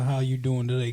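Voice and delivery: normal talking voice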